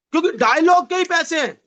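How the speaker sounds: usual speaking voice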